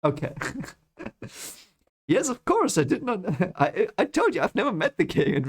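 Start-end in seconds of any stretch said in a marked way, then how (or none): 2.1-5.4 high, hurried mock protest; exasperated protest. Rand insisting to Barthanes, in a high, flustered mock protest. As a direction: blurt it high, tripping over a laugh.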